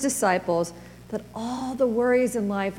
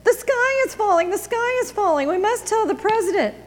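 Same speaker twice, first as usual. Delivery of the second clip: high, squawky panicked hen cry; panicked hen voice, much higher; clucking hen; 11 semitones higher, 3 dB louder than usual. A narrator's reading. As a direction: cluck it out like a frantic chicken. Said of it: "she said".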